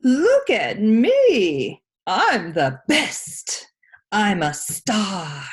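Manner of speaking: loud, slow, show-off shout; slow boastful shout, drawn out. Acted. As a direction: bellow it like a spoiled star. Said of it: "He'd shout".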